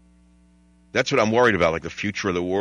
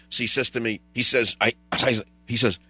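normal talking voice

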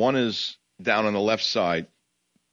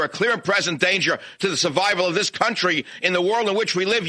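berating the nun, climbing into a yell; worked up; 7 semitones higher than usual. Real, not acted. Radio host yelling at a nun on air, furious. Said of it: "I'm getting so worked up".

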